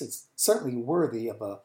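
ordinary talk, nothing marked

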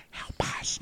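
silently mouthed, just breath; mock mouthing a plea for help. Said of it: "I clearly saw him mouth".

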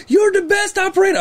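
very high gushing praise; imitating an excited high-voiced fan; Jerry. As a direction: pitch it way up and gush. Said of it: "Doesn't he have the high voice?".

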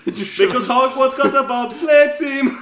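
slow, high, loud put-on Jamaican; Jamaican accent. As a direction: boom it slowly in a Jamaican voice. Cool Runnings joke, Jamaican voice.

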